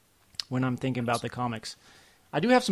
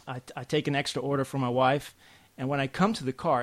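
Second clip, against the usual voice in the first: ordinary talk, nothing marked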